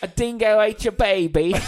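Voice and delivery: loud, laughing mock Australian accent; hammed-up Aussie. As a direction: blurt the famous line in a jokey Aussie accent. Quoting in Aussie voice.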